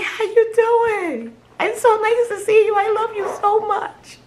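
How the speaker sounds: beam at a dear friend finally found; overjoyed greeting. Retold or imitated. Greeting herself in mirror.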